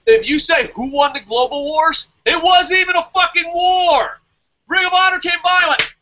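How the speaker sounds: high, loud furious rant; fed-up shouting rant; angry rant. Real, not acted. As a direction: shout it at them in disbelief. Ranting at New Japan.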